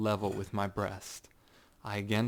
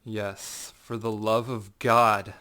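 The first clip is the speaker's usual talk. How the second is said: slow, louder, drawn-out sneer; mocking echo; 6 dB louder, slower than usual. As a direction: throw his plea back slowly and cruelly. Montresor mocks the plea.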